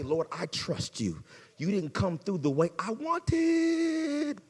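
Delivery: soft, slow, hushed prayer fading to a whisper; quiet prayer. Retold or imitated. Believer quietly praying to the Lord, hushed and slow. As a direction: pray it softly, surrendering. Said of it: "And you gotta say".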